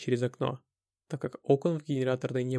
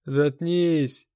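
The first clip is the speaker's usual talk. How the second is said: loud, drawn-out order from frayed nerves; different, nervous voice; 5 dB louder than usual. A narrator's reading. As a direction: repeat the order as your nerves give out. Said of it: "но уже другим голосом". (громко, на сдающих нервах)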